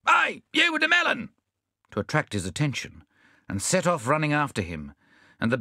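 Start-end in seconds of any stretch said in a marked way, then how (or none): 0.1-1.3 high-pitched hailing shout; shouted call. A narrator's reading. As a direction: call out to attract his attention. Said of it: "They shouted".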